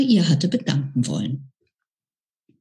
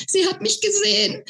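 squeaking. Acted. Flo squeaks it again, high but fading. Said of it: "quiekte er".

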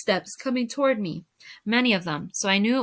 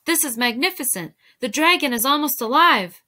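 higher, louder wonder; thrilled apprentice, voice lifting; excited marvelling; 5 semitones higher, 5 dB louder than usual. A narrator's reading. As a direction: exclaim in rising admiration. Apprentice marvels at master's painting.

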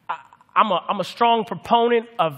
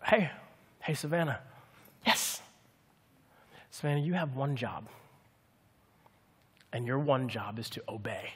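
calm fatherly instruction; 7 semitones lower, 11 dB quieter than usual. Retold or imitated. Father calmly instructs his daughter.

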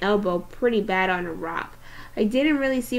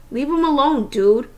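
louder, firm warning; 4 semitones higher, 6 dB louder than usual. A narrator's reading. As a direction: stand up to the bullies. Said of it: "said Amos".

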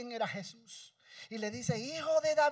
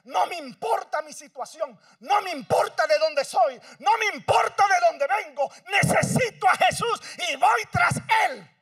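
determined cry; 5 semitones higher, 9 dB louder than usual. Acted. Desperate woman resolving to follow Jesus, building shout. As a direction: cry out her resolve, louder each line. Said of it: "y dijo".

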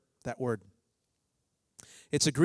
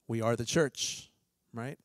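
normal talking voice

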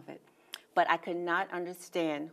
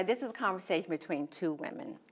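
ordinary delivery

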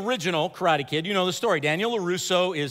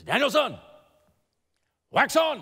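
sharp command; 7 semitones higher, 4 dB louder than usual. Retold or imitated. Miyagi before the punch.

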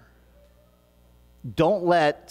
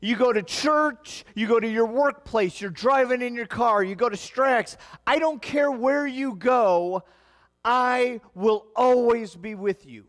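raised, slow preacher's cadence; fervent pulpit delivery; preaching; 8 semitones higher than usual. Acted. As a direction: preach it higher and slower to the room. Pastor preaching to congregation.

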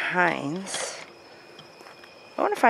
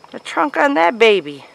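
amazed; 6 semitones higher, 10 dB louder than usual. Real, not acted. Amazed by tree.

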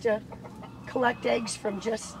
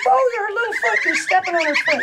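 high, louder cry of tender concern; fussing concern; 8 semitones higher, 5 dB louder than usual. Real, not acted. Keeper seeing a bird's foot stepped on, high fussing concern. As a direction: fuss over a tiny creature's mishap.

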